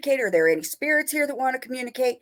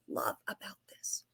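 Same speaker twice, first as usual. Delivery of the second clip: soft, breathy whisper of a secret joy; 16 dB quieter than usual. Real, not acted. Guest repeats to the host what she loves, whispered. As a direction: hush it like a confession.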